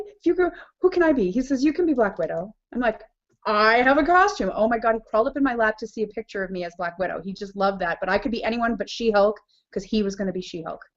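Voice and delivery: normal talking voice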